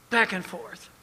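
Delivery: ordinary delivery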